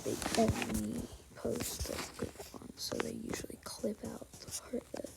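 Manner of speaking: soft unvoiced whisper. Real, not acted. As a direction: whisper it under the breath. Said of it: "I have to whisper".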